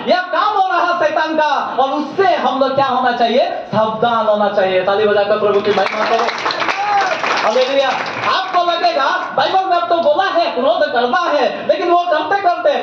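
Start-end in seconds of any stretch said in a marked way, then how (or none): 4.8-7.9 lower, quick call to clap and praise the Lord; rally the congregation to clap; preacher's call to praise. Real, not acted. (तालियाँ बजाने का तेज़ आह्वान)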